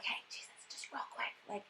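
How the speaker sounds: whispered aside; sneaky ask. Retold or imitated. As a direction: disciples secretly asking Jesus for a favor, whispering. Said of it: "being like".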